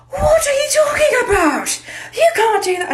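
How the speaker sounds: very high, screechy mock panic; panicking teacher. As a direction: imitate a teacher shrieking in disbelief. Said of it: "Miss Harrington's like".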